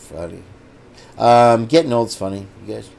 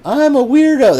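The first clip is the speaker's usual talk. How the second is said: squeaky quoted line; 11 semitones higher than usual. Retold or imitated. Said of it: "it was like".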